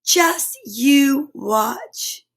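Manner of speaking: stretched out slowly; very slow, drawn out. Acted. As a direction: take your time, teasing. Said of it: "said Kip".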